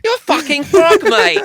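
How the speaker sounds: high, loud, laughing halfling mockery; mock halfling jeer.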